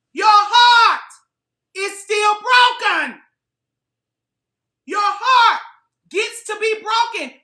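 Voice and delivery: full-force sermon about heartbreak; impassioned preaching. Real, not acted. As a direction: preach it hard at the audience.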